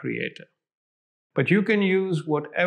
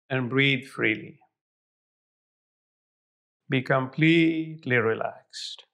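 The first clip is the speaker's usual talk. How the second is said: right in your ear; 3 semitones lower, slower than usual. Acted. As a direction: ease them into calm, close up. Begins guided relaxation, eyes closed.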